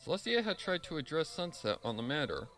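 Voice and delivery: normal talking voice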